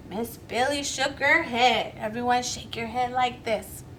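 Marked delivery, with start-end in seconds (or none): none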